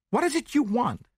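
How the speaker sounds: very high woman's voice; anxious woman. A narrator's reading. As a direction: turn on him with a high demand. Woman turns sharply on Poirot.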